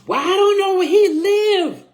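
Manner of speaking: high, loud mock scream of the grandmother. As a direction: screech it out across the parking lot. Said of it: "she screams out".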